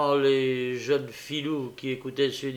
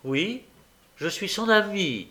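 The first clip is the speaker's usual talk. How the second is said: higher, indignant retort; defiant, raised-pitch comeback; angry retort; 7 semitones higher than usual. A narrator's reading. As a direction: fire back in mild anger. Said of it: "récria la jeune fille avec colère".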